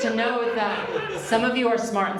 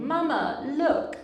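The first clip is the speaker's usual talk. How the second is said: high, bright call of mama look; a child tugging at his mom for attention; normal child calling; 9 semitones higher, slower than usual. Retold or imitated. Comedian imitating how a normal child calls to his mom. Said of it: "like a normal child would".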